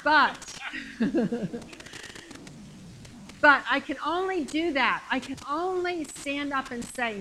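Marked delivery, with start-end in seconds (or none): 0.4-3.4 amused laugh trailing away; breathy chuckle. Real, not acted. After confessing a complaint to God, amused chuckle.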